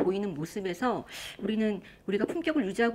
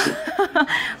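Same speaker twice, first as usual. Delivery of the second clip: short high-pitched laugh; 4 dB louder than usual. Real, not acted. Speaker amused by own irritation example, laughing. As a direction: burst out in a high giggle.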